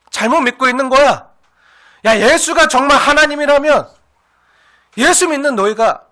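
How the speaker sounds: loud, forceful challenge in a scoffer's role; acted mocking accusation. Neighbours challenging believers in Jesus, loudly accusing. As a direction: hammer the taunt at the believers. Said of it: "참수하고 조롱하고 비난하는".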